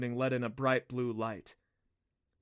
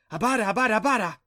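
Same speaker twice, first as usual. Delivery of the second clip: high, loud excited chirruping; excited little creatures; 10 semitones higher, 10 dB louder, much faster than usual. A narrator's reading. Little creatures ready to escort mother, chanting excitedly. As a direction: pipe up loudly, over and over.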